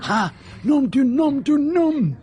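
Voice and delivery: a high, loud, slowed cry of discovery; sudden realisation. Acted. Detective realising whom he saw, loud startled cry. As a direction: burst out as the memory hits. Poirot suddenly remembers the face.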